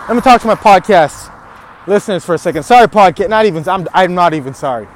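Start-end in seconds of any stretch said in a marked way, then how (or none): none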